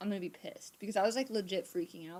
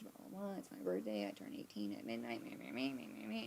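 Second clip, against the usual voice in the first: dismissive mumble; 8 semitones lower, 6 dB quieter than usual. Retold or imitated. Mocking her own rambling answer.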